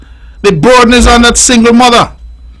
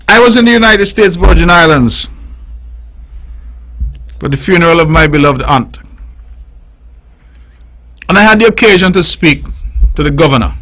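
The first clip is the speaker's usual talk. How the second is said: normal talking voice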